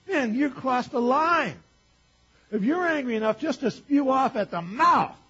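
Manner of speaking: loud, high preaching building to a shout; full-force preaching. Real, not acted. Preacher warning against anger that leads to sin, full-force preaching. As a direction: build in force, stretching out the last phrases.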